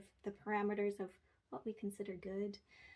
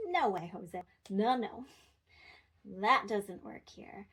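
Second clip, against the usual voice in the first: louder, sassy no way Jose, slow at the end; 5 dB louder, slower than usual. Shown as a demonstration. Parent to older kid, sassy and loud. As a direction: give it some teen-level sass. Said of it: "But you can be sassy".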